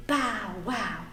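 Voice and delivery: ordinary delivery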